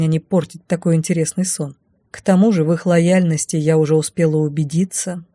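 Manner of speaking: plain reading voice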